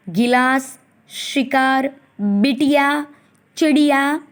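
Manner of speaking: slow dictation to a class; reading word list aloud. Acted. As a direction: call out each word crisply for children.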